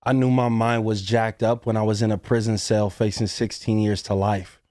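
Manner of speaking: ordinary talk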